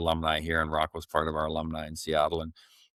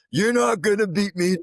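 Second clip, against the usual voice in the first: retold yell; 7 dB louder than usual. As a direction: yell it at the machine like a fight. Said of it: "he's yelling at the treadmill".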